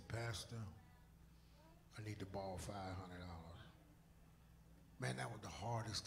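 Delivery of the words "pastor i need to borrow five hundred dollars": soft plea. Retold or imitated. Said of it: "call my pastor and say".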